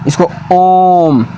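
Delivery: ओम held very long and slow; prolonged ओम. Shown as a demonstration. Teacher demonstrating a pluta vowel in ओम. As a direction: chant out ओम, stretching the vowel. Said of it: "उसके बाद ओम".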